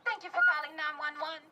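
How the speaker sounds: measured phrases building to high urgency; impassioned poetry performance; spoken-word protest poem. Acted. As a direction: recite a poem, rising into a desperate plea.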